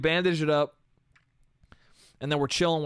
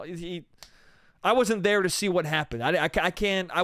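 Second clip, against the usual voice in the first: ordinary talk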